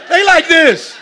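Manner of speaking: rising, loud pulpit call-out; preaching at full force. Acted. Pastor teasing his congregation, preaching loud. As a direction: preach it hard at the pews.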